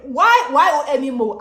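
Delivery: loud, high re-enacted outburst; hammed-up frantic protest; exasperated yell. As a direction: shout it in acted-out desperation. Yelling at cousin while in pain.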